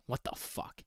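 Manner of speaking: breathless whisper. Retold or imitated. Reading his text to viewers, whispered. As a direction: whisper the line in disbelief.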